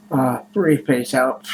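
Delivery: normal talking voice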